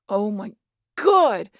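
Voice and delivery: loud exasperated cry; exasperated outburst. Acted. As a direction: blow up in frustration. Exasperated at nosy hunter.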